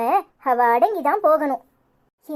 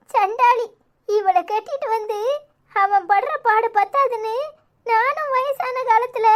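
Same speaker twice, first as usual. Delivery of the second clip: tearful lament; 6 semitones higher than usual.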